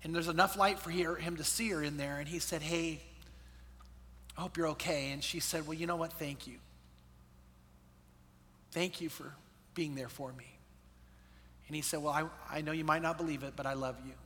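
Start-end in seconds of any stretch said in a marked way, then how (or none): 2.6-5.1 soft, hesitant bedtime concern; tender check-in. Retold or imitated. Stepdad at bedroom door at night. 5.7-10.6 very soft, low, halting gratitude; heartfelt thanks. Retold or imitated. Daughter softly thanks stepdad at night. 12.0-14.1 low, hushed, tentative affection; tender confession. Retold or imitated. Stepdad tentatively tells her he loves her.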